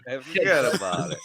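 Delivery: lightly mocked Bronx accent; imitation Bronx wise guy. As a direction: echo the Bronx catchphrase playfully. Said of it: "you come from the Bronx sometimes".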